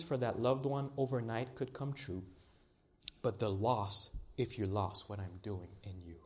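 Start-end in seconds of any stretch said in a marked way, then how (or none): none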